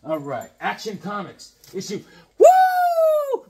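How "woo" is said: excited whoop. Real, not acted. Thrilled at a key issue.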